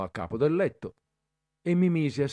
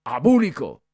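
louder jeering yell; shouted insult; 3 semitones higher, 8 dB louder than usual. A narrator's reading. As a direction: fling the word at him. Cadet shouting at boy. (Bertoli urla l'insulto al ragazzo, più forte)

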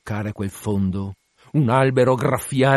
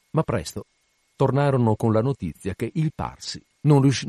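ordinary delivery

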